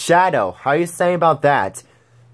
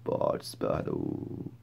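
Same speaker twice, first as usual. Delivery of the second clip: whispered announcement; 16 dB quieter, much slower than usual. Acted.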